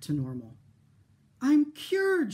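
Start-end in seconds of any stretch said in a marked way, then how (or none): 1.4-2.3 high, loud cry of joy; overjoyed girl shouting; joyful shout. Acted. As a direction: shout it with relief. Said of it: "she shouted".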